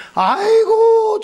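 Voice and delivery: drawn-out, high and loud "아이고"; a loud, slow gasp of mock amazement; mock exclamation. Churchgoer reacting to the pastor's confession, drawn-out exclamation.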